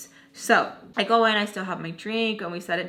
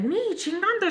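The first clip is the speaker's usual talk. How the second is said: much higher, incredulous echo; mock indignation; 11 semitones higher than usual. Accused woman throwing the complaint back, high mock indignation. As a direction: imitate an offended comeback. Retold indignant comeback in quarrel.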